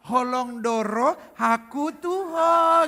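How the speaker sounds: high, loud, very drawn-out crooning; over-sweetened. Shown as a demonstration. Comic showing the forbidden sweet way, loud and drawn-out. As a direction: stretch "Holong doro haku tuho" into syrupy long vowels. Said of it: "nggak boleh dimanis-manisin, nggak bisa gini".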